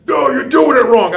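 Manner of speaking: slow, high, loud scolding coach voice; Billy Robinson. Retold or imitated. Old coach scolding his student for doing the move wrong, loud scolding. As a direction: drag it out like a grumpy old coach correcting you. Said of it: "best Billy Robinson imitation".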